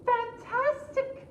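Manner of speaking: very high, sweet princess; high flattering princess. Acted. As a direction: flatter the dragon in a high girlish voice.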